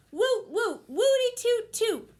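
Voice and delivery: high, loud chanted woots; cheering. Acted. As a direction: whoop it up. Rodents cheering.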